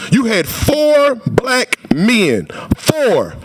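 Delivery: slow down and hit FOUR twice; stress on four. Real, not acted. Testifier to the committee, stressing FOUR slowly and firmly.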